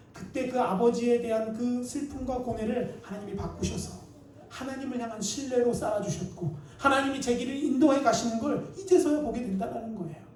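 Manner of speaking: ordinary delivery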